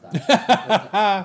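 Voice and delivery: slow, laughing delight. Real, not acted. Recalling the fat kid blowing up the school, laughing. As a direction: laugh through the words, slowly.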